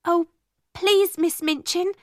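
high, pleading cry. A narrator's reading. Ermengarde begging Miss Minchin for mercy, crying out. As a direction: plead with the headmistress. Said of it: "cried Ermengarde".

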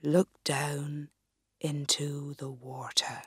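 put-on low, whispered old man's voice; whispering sage. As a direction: breathe it like a secret spell. Wise man instructs Gertrude.

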